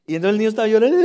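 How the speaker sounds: riendo a carcajadas mientras lo cuenta; laughing. Real, not acted. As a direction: suelta la risa al recordarlo. Recuerdo gracioso del niño llorando. (risa franca y aguda)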